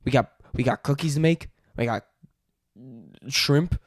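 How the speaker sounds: loud improvised Aussie patter; practised broad Australian accent. Shown as a demonstration. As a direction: belt out amateur Australian. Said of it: "let's try Australian".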